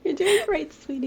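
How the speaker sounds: high-pitched doting encouragement; Kris Jenner. Acted. Co-host echoes the momager cheering them on, high mock-encouragement. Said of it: "we're really just Kris Jenner here".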